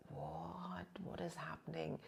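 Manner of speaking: hushed, shaken disbelief; stunned whisper. Retold or imitated. Seeing her fierce form, shocked hushed whisper. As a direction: gasp it out under breath.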